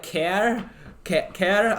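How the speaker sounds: "care" said for car, in mock Canadian; put-on vowel, "care" in place of car. Shown as a demonstration. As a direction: try out "care" as an accented car. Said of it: "have to pronounce car like care".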